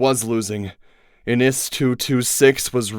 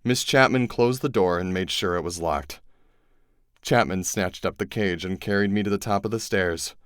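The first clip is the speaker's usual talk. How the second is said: ordinary delivery, nothing marked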